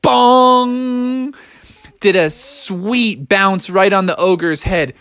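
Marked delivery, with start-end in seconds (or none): 0.0-1.3 high, ringing bong; impact noise. Acted. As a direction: ring it out like a spring landing. Imitates bounce.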